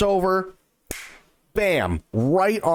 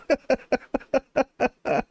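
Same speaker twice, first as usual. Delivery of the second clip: delighted giggle; high laugh; 10 semitones higher than usual. Real, not acted. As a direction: laugh happily. Laughs about new hero.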